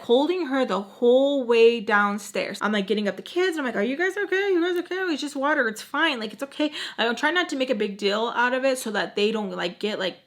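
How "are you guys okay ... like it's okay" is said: high, light comforting voice; retold reassurance. Nanny checking on the splashed kids, high light reassurance. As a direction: keep it high and breezy for little kids.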